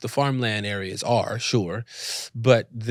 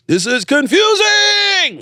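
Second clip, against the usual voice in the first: high, loud, drawn-out wail; exasperated shout; 9 dB louder, much slower than usual. Retold or imitated. Actor overwhelmed by accents all round, exasperated shout. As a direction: cry it out slowly in comic overwhelm.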